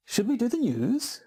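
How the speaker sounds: real question tune on news; actual question. Real, not acted. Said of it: "That was an actual question".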